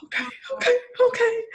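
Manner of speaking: high, loud, exasperated okays; squeaky mock surrender; giving in. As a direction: cave in like a worn-out parent. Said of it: "I'm like".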